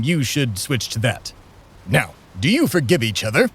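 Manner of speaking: ordinary talk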